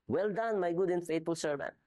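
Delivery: high, beaming praise; pleased master's high praise; delighted master. Retold or imitated. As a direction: pour joy into the praise like a proud boss. Master praising servant, repeated with feeling.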